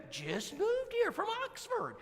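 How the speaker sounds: high, slow lady voice; Brenda's voice. Retold or imitated. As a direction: lift it up high and drawl it like Brenda.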